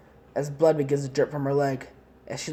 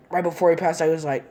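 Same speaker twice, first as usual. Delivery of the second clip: ordinary delivery, nothing marked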